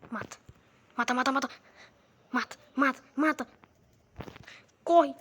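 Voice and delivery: loud urgent kill commands; frantic shouting. Real, not acted. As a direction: bark it out in a frenzy. Frantic fight against horde. (gritando desesperado)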